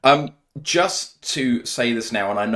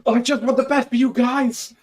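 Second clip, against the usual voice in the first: much higher, hammed-up sincere squeaky plea; mock sincere plea; 5 dB louder than usual. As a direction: overdo the earnest caring boss. Mock sincere pitch to postgrads.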